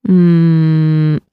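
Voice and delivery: ordinary talk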